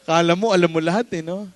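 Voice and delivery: ordinary talk, nothing marked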